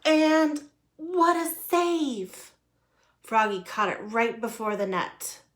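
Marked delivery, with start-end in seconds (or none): 0.0-2.5 high, loud, slow drawn-out exclamation; excited sportscast cry. A narrator's reading. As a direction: hail a great play, stretching it out. Narrator hails the save.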